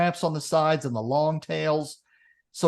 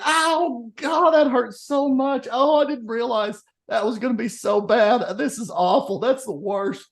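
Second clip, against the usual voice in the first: pained businessman; 8 semitones higher than usual. Retold or imitated. Businessman moaning to the farmer in pain. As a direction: whine like you just got kicked hard. Businessman after being kicked.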